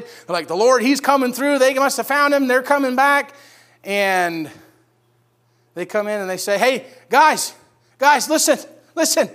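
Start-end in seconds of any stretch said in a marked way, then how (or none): 0.5-3.3 higher, excited household spotting the servants. Acted. Family seeing the servants come up the driveway, excited. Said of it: "they're excited". 6.6-9.3 high, loud, eager call for attention; excited announcing. Acted. Servants coming in to the waiting family, excited announcing. Messengers bursting in with news.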